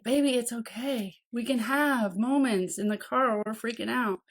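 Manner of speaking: quietly calm yourself down; soft tender inner voice; soothing self-talk. Shown as a demonstration. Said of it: "you need to say it to you too".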